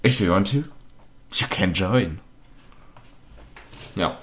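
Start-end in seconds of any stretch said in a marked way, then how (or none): 0.1-2.2 sleazy English spam message voice; spam bot voice. Retold or imitated. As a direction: read it like a shady bot message. Reading out a spam DM.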